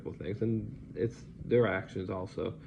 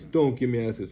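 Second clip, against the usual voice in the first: higher, loud repeat of the scolding; stern scold; 4 semitones higher, 7 dB louder than usual. Retold or imitated. Nurse repeating the scolding, higher and loud. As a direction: snap it out at the patient again. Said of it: "pointing his finger at my face".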